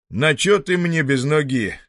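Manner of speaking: громче и выше, с досадой; рявкни с досадой; грубый хозяин. Acted. Хозяин ругает калеку. (хозяин с досадой на сироту, громче)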